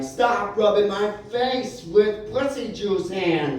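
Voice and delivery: high, slow exasperated yell; drawn-out protest; wanted-to-shout outburst. Retold or imitated. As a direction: let out the shout you held back. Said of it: "wanted to shout".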